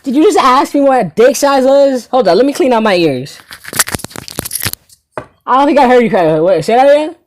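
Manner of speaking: loud, high-pitched disbelief; hammed-up astonished questioning; incredulous.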